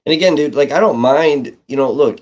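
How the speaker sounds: loud emphatic. Real, not acted. Heated rant about noisy neighbour.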